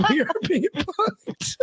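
high pitched through laughter; giggling out the words; laughing. Real, not acted. Losing it over the name.

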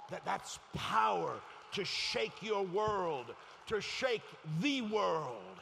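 high, loud, drawn-out roar; slow shouted climax; full-force preaching. Real, not acted. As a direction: thunder it across the congregation.

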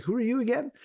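father with Alzheimer's, suddenly lost again, a light confused voice. Retold or imitated. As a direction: ask it as if meeting him for the first time. Father forgets his son again.

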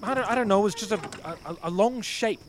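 stammering in panic; scared. Acted. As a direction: voice climbing with fear. Sees creature, panicking.

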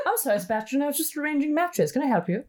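high, prim period-drama voice; posh British drama voice. Retold or imitated. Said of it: "And it's like".

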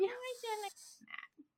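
high, very soft pretend crying; tiny mock whimper. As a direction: squeak out the regret in a tiny voice. Acts out scared regret, jokingly.

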